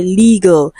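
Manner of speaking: "legal" with long ee and schwa ending; corrected pronunciation. Shown as a demonstration. Teacher repeats for students, "legal" said as LEE-gul. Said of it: "it is legal".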